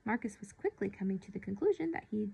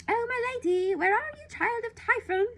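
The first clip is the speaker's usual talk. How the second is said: much higher and louder shrill wail; wailing nurse; 8 dB louder than usual. Acted. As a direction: wail it out like a fretting old nurse. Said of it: "wailed the voice".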